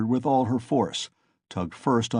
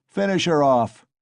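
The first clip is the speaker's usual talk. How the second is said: shout; 4 semitones higher, 5 dB louder than usual. A narrator's reading. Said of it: "shouted Mikolka".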